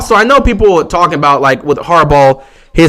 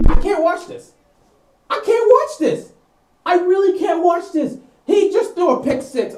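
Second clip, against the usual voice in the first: high, softer wail of dismay; real despairing disbelief; 14 semitones higher, 6 dB quieter than usual. Real, not acted. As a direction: whine helplessly, high and away from the mic. Despairing after the interception.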